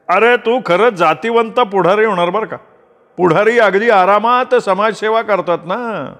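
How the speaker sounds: high-pitched, amused mock praise; teasing elder brother. A narrator's reading.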